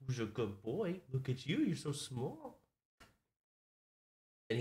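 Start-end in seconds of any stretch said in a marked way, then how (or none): 0.1-2.6 puppy talk. Real, not acted. Cooing to puppy.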